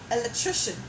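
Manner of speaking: Electrician with murmured final un; schwa ending demo. Shown as a demonstration. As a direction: model the weak last syllable. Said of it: "The word electrician".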